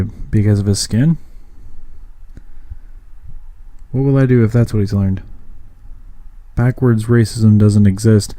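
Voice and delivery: rhythmic, projected poetry recital; spoken-word poem. Acted. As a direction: deliver it as a steady, emphatic slam piece. Performs own spoken-word piece.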